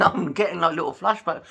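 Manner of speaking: embarrassed laugh into the words; laughing through it. Real, not acted. Vlogger laughing at his own flashback, giggling. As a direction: laugh it off while admitting it.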